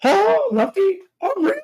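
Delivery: a failed falsetto through a sore throat; strained falsetto try. Acted. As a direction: reach for a falsetto and crack. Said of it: "See, I can't do it".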